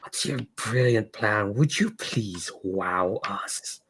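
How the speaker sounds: slow, hushed mockery from an eerie entity; taunting otherworldly voice. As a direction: taunt them softly, drawing out each word into a near-whisper. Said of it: "the laughter stops and says".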